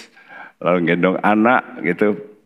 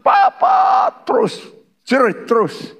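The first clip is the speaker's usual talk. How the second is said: put-on high wailing child voice; child crying out for papa; screaming child. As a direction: keep shrieking like a toddler. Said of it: "Terus tutup jerit".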